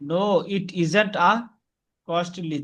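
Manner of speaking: slowly, word by word; slow model sentence. Shown as a demonstration. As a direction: spell it out slowly for the learner. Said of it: "teacher models sentence". (ধীরে ধীরে উচ্চারণ)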